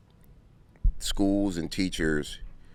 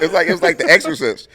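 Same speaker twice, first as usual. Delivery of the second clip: usual speaking voice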